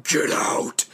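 raspy, voiceless spooky command; creepy whispered growl of a warning; eerie game voice. Retold or imitated. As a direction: imitate a menacing voice in rough whisper. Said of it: "then the voice just goes".

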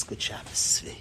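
soft, low, hushed good Shabbos; gentle. Retold or imitated. Rebbe suddenly calm, blessing the students, gentle and soft. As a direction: say it gently, calmed down. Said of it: "in the most gentle of ways".

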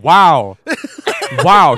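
loud, exaggerated wow; dumbstruck awe. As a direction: play the speechless fan, booming. Retold dumbstruck handshake.

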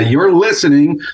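radio station ID. Shown as a demonstration. Reading the show's station ID for the host, announcer style. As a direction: sell the show name like a promo. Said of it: "I had to read that".

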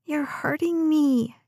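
put-on high, whiny child's voice; complaining little girl. A narrator's reading. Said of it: "Des complained".

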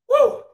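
a loud, high whoop of pure excitement; jacked-up woo. Real, not acted. Host whoops to his viewers, all jacked up. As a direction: let out a wild, pumped-up holler. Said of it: "[name] all jacked up".

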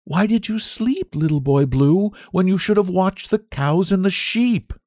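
high, gentle squire's daughter; comforting question. A narrator's reading. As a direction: soothe a crying child while asking. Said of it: "comforted him, asking".

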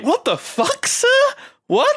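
high-pitched incredulous reaction; mock bafflement. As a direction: play utterly thrown by what was said. Acted baffled reaction to Quint's rant.

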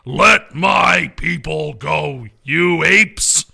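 very slow, booming Heston; Charlton Heston impression. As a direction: proclaim it like Moses to apes. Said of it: "Charlton Heston".

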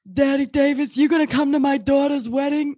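high-pitched mock mob-boss mouse; mouse from Zootopia. Mouse asking about his daughter's wedding, high character voice. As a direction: pitch it up as the tiny cartoon mouse. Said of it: "It's like that mouse".

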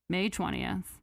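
ordinary talk, nothing marked

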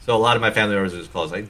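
usual speaking voice

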